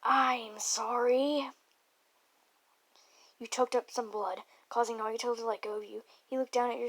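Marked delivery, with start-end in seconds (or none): 0.0-1.5 very slow, loud, stretched vowels; drawn-out apology. Real, not acted. Reader breaking off from the story, loud drawn-out apology. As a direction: stretch both words right out.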